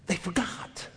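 drops to a whisper after shouting; hushed whisper. Real, not acted.

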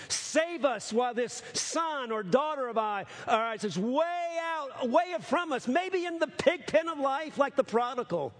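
high, slow, pleading prayer; crying out to God. Shown as a demonstration. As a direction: lift the voice up to God, drawn out and urgent. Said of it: "I mean shouting it out".

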